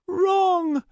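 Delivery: insist, not giving an inch; insistent high girl voice. A narrator's reading. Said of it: "Starbreeze said again, insistent".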